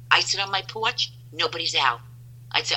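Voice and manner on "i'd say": normal talking voice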